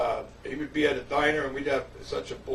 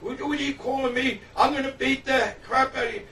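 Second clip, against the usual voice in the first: a staged angry threat, pitched higher; mock fury; 8 semitones higher, 3 dB louder than usual. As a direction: play the fake-mad bully. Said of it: "like he's really mad".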